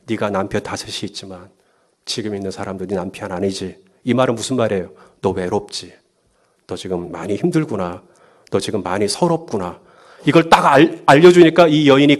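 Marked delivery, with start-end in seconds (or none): 0.0-3.8 very soft, low voice; gentle 예수. Acted. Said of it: "예수님이 이 여인에게 뭐라 그래요". 5.2-9.8 soft, low, tender empathy; compassionate 예수. Acted. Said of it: "예수님이 이 여인에게 뭐라 그래요".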